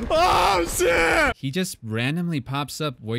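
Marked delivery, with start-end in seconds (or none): 0.1-1.4 scared yell. Real, not acted. Jump scare in horror game.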